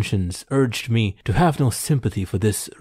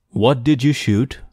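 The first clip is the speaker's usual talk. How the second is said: sharper and louder, a stern demand; stern interrogation of a poacher caught out; sterner demand; 4 semitones higher, 4 dB louder than usual. A narrator's reading. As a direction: press him hard, no patience left. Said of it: "I demanded in a sterner tone".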